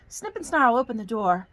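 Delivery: put-on storybook narration in a folksy Southern accent; hammy Southern storytelling accent. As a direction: read it like a Southern yarn for children. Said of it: "I get a Southern accent".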